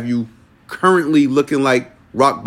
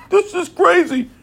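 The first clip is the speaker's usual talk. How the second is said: high-pitched laughing exclamation. Real, not acted. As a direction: squeal it through laughter. Laughing at own message.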